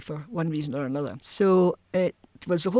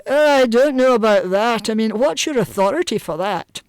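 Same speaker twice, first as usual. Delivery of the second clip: resistant Edinburgh lawyer, higher and louder; put-on Edinburgh legal objection, pitched up; 4 semitones higher, 6 dB louder than usual. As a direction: challenge them like a doubting Edinburgh lawyer. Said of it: "If you phoned an Edinburgh lawyer".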